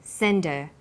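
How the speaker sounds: SENDER, send her linked with no H; slow SENDER, the H of her gone; dropped H. Shown as a demonstration. Teacher showing how it's pronounced, H dropped in SENDER. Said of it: "we drop the initial h sound".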